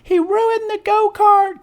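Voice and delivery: high, slow whiny complaint; complaining. A narrator's reading. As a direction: moan about it. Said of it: "complained another".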